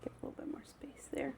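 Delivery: quick soft whisper to yourself. Real, not acted. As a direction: whisper it fast under your breath. Whispering aside while cooking.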